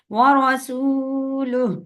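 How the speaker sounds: व रसूलुहु with the waw sakin pulled a little long; slightly stretched. Shown as a demonstration. Teacher demonstrating the lengthened vowel, slow. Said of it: "थोड़ा सा खींच के पढ़ेंगे".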